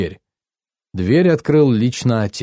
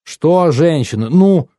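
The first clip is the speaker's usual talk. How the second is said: slow, indignant challenge, rising on the repeat; nervy aunt demanding an answer; 4 semitones higher, 4 dB louder, slower than usual. A narrator's reading. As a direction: draw out the question, pushing higher the second time. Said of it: "нервная тётя Джесс".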